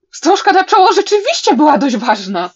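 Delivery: loud, high cry of mortified realisation; panicked outburst. A narrator's reading. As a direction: blurt it all out at full volume. Mortified realisation after stammering. (głośny, spanikowany okrzyk)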